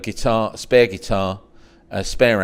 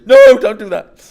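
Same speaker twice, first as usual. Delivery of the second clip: loud, high, slow no; loud slow warning; 12 semitones higher, 14 dB louder than usual. Retold or imitated. Billy telling the young poet not to quit, loud and slow. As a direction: lean on it like a stern warning.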